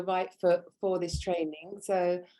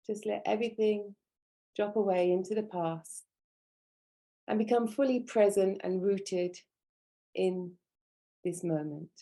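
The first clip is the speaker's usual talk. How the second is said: measured meditation voice, long silences between instructions; guided meditation. Real, not acted. Teacher grounding students at a training's opening, guided meditation. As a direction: guide their breathing in calm, spaced phrases.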